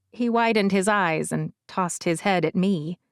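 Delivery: ordinary delivery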